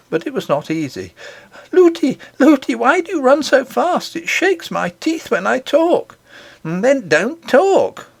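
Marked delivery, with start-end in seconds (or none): 1.7-6.2 put-on high, bouncing child's voice calling out; a little girl chattering while being rushed along. A narrator's reading. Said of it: "She kept on chattering". 6.6-8.1 slow, clipped nurse's retort; a short, impatient answer, drawn out; curt reply. A narrator's reading. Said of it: "said Lootie".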